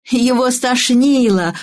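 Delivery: slow, high sing-out answer; schoolchildren in chorus. A narrator's reading. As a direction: chant it like a class answering. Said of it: "хором ответили школьники". (протяжно, высоко, как школьники хором)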